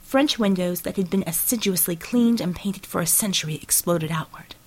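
usual speaking voice

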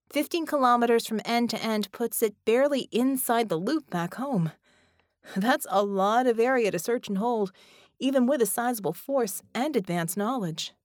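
Ramona's voice. A narrator's reading. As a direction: voice Ramona, flustered. Ramona speaks as character.

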